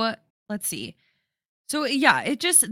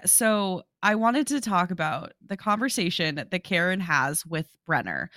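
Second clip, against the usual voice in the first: usual speaking voice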